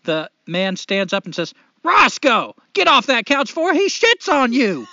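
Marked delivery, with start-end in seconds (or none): 1.8-4.9 loud, high, alarmed scolding; stern father orders the dog off the couch, shouting; father's shout at the dog. Acted. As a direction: yell the punchline like a fed-up dad.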